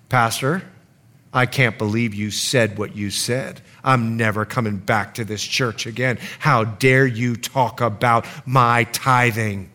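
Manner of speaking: low, soft indignation; offended churchgoer. Acted. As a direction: confront the pastor in a low, controlled voice. Acting an offended churchgoer confronting him.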